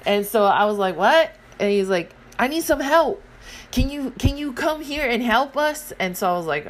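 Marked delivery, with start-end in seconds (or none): 0.9-1.3 sharp what. Retold or imitated. Annoyed retort to the kid. 2.4-5.9 put-on kid pleading.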